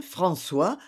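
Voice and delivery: ordinary delivery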